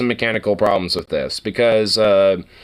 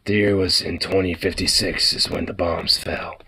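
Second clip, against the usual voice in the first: trailer narrator; 7 semitones lower, 4 dB quieter than usual. Pretend.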